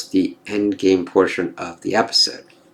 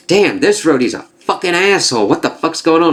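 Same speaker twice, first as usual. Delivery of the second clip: higher-pitched mock outrage; indignant viewer reaction; 7 semitones higher than usual. Viewer reacting to Rhodey's behavior, indignant. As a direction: act out being appalled at someone.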